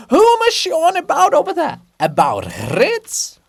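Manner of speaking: very high, far-off call; distant giant voice. Acted. Volodymyr's monument calling from afar, very high distant voice.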